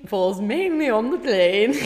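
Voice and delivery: slow, careful posh English elocution; Eliza Doolittle. Acted. Finishing the elocution line for a friend, posh English. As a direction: draw it out in mock-posh English. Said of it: "Eliza Doolittle, where she learns to speak".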